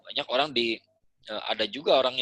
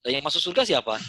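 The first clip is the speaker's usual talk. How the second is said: ordinary delivery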